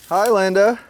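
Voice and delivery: cheerful greeting. Real, not acted. Cameraman greeting her loudly.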